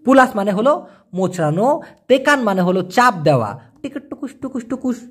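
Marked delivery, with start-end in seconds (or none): 3.8-5.0 soft clicking noises for pressing a button; pressing sound effect. Shown as a demonstration. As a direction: mimic the tapping of pressing down, softly. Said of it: "এই যে চাপ দেওয়া". (চাপ দেওয়ার মৃদু শব্দ নকল করে)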